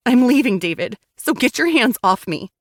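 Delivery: loud and firm, pitch up; defiant shout. Acted. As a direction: stand up to him loudly. Wife defying husband.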